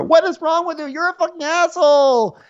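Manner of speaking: high, loud, hammed-up rage; mock outrage. Ramona on the boat in a high, loud hammed-up rage. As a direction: scream it like a boat fight. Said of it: "And it's like".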